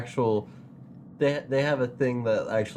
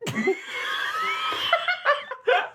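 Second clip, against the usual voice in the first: squeaky door imitation. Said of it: "You do one".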